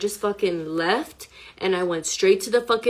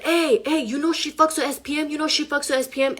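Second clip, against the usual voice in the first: higher-pitched mimicry of a man tattling; retold goading call-out; 6 semitones higher than usual. Imitating the man telling others about her, goading. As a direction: imitate him calling out, raised pitch.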